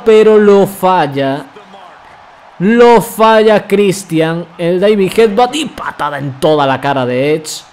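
rising hype on the big move; wrestling commentator. Real, not acted. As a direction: call the move like a ring announcer. Calling a wrestling move live. (narrador de lucha emocionado)

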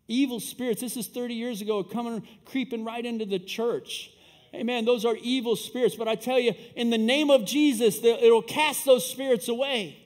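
fired-up preacher warning the church; preaching. Real, not acted. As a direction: preach it with mounting force. Preaches after a quiet anecdote.

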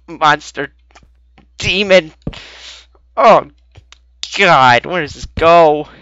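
loud, jumpy panicked yelps; startled, blurting in fright; real panicked outbursts; scared yelps. Real, not acted. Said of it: "I don't know why I'm scared".

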